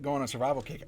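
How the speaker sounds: ordinary delivery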